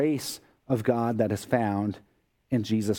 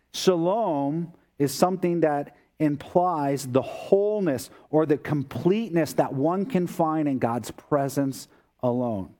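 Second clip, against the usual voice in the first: usual speaking voice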